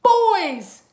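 loud, high, drawn-out pounce; spring it on them to scare; big menacing ghost-story shout; scary boom. A narrator's reading. Scaring the twins.

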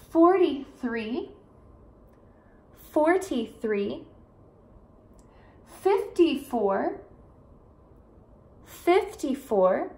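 "thirty-one" to "eighty-nine" spoken very slowly; slow, careful numbers. Shown as a demonstration. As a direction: stretch each number out for learners. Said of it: "pra vocês ver e praticar".

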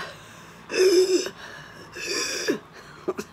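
wheezy breathless laugh. Real, not acted.

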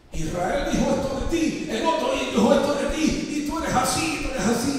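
a hint of a hostile accuser; retold accusation, lightly voiced; accusing leader.

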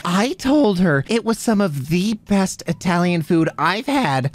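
usual speaking voice

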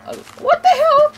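high, loud startled cry; shocked yell. Real, not acted. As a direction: shriek of sudden surprise. Sudden shock at game view.

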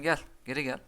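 ordinary delivery

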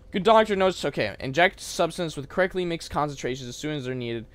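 ordinary talk

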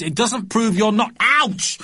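put-on high boyish argument breaking into a yelp; indignant kid, pitched well up; protest cut by ouch. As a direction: argue high, then yelp as you're squashed. Boy squashed mid-argument.

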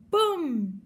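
loud, high cry of boum; crash boom. Pretend. Narrator crashing the toy car into a tree, loud boom. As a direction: shout the crash like a kid.

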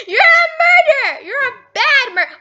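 high, loud half-laughing wail; mock-sobbing accusation at the killer; laughing cry. Real, not acted. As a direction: shriek it while laughing.

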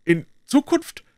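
a high, disbelieving question; incredulous echo. A narrator's reading. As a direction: throw it back in disbelief. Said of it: "wiederholte Silas".

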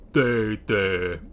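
low, drawn-out consoling voice; Big. Acted. Biggest naughty Lloyd soothes Oisin, in a low voice. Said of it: "inked Big".

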